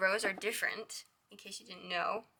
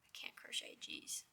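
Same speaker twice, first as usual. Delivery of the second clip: whispered under breath, annoyed at own mistake; frustrated whisper to self; 13 dB quieter, slower than usual. Real, not acted. Crocheter fumbling a stitch, to herself: exasperated whisper. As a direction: mutter it to yourself in a hushed breath.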